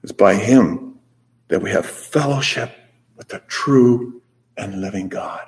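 ordinary talk, nothing marked

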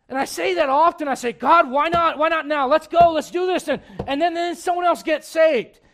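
loud, high, driving sermon voice; full-force preaching. Acted. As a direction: preach it out to the whole church. Preacher shouting his prayer story.

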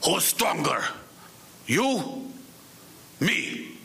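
mock-fierce Russian-accented growl, spaced out. Father challenges his last cigarette, growling in a Russian accent. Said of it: "with a growl, he would say in his Russian accent".

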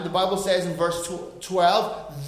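usual speaking voice

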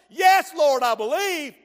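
high, loud yes, near a shout; loud answer. Acted. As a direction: blurt it out as Martha. Martha answers Jesus.

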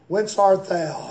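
normal talking voice

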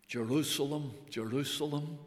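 soft, mournful double call; lamentation. Acted. Preacher voicing the Lord's lament, soft mournful calling. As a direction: let the name fall softly in grief. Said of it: "You see the lamentation".

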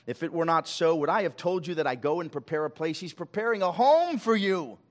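loud, high pulpit proclamation; fervent preacher reading Jesus' promise to the congregation; preaching at full force. Acted. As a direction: lift the scripture like a rising sermon cry. Preaching crescendo on scripture.

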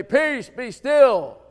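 preacher's loud imitation of a divine command; commanding the storm. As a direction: call it out slowly with authority.

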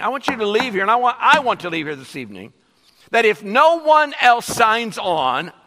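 loud, high, urgent preaching; rally the congregation; full-force pulpit appeal; fervent preaching. Acted.

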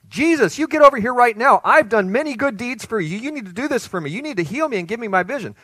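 loud, high, pushy demand. Acted. Preacher voicing an entitled beggar, loud and demanding. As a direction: bark orders at Jesus like he owes you.

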